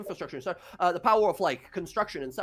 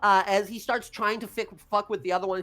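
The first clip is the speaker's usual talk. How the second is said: ordinary talk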